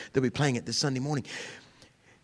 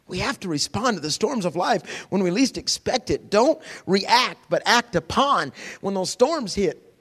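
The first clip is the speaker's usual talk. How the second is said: ordinary talk, nothing marked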